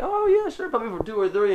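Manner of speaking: very high, soft mock customer voice; agreeable customer. Customer orders a few cookies, high and obliging. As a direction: imitate a customer happily giving in.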